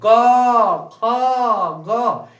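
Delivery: क, ख, ग each called out long, separately; loud, drawn-out क then ख, ग; letter-sound demo. Shown as a demonstration. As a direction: sound out क, ख, ग with open held vowels. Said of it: "बोलने में क्या हमारा उच्चारण आ रहा है".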